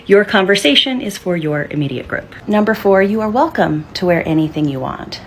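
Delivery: ordinary delivery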